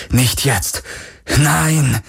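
contained scream of refusal; inward cry. A narrator's reading. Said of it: "schrie alles in ihm auf".

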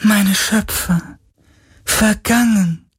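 high, slow mournful wail; lamenting ghost. Acted. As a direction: lament the lost creators. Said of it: "klagte der Geist". (klagender Geist)

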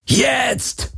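high yelled command; roaring command. Acted. Garland yelling at Kirai to run for the ship. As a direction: shout the order, all out. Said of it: "brüllte Garland".

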